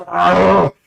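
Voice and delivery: high, loud yelling guard; startled guard roaring orders; angry guard shout. Acted. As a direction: bellow at the men to attack. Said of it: "He goes".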